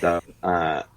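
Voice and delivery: hammed-up American accent, laughing through it; a British guy doing a cheesy American accent; mock American accent. British guest asked to do his American accent, mock American accent. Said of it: "do the American one".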